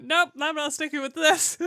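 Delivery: loud, half-laughing retreat; loud mock give-up. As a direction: blurt it out loudly. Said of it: "I did one line and went".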